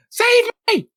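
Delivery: high, loud panicked squeal; pompous fussy courtier. Acted. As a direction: shriek for help like a pampered noble. Courtier crying for help.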